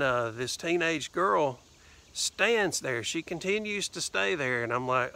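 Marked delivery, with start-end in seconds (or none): none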